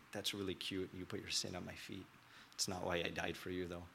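quiet, gently amused correction; a soft smirking rebuke, hushed; soft amused Jesus. Retold or imitated. Jesus gently teasing the praying man, hushed and amused. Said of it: "he kinda smirked and he's like".

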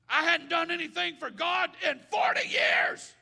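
loud, high preacher's cry; preaching. Acted. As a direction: preach it out to the church. Preaching at full force.

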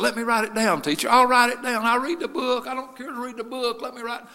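high-pitched begging, retold; pleading schoolboy. Shy pupil asking teacher to write it down, high pleading.

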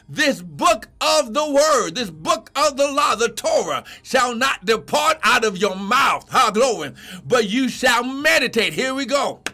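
impassioned, driving sermon delivery; full-force preaching. Real, not acted. Preacher urging believers to meditate on the word, full-force preaching. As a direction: drive each phrase home to the congregation.

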